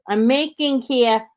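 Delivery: high, slow Jersey accent demo; thick New Jersey accent. Shown as a demonstration. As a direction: stretch the vowels like a thick Jersey talker. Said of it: "train me to not say Inga".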